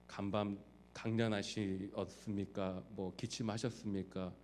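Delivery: put-on slow, soft, stiffly formal child addressing father; bow and greet with mock formality, slowly. (아버지께 지나치게 격식 차린 느린 문안 인사)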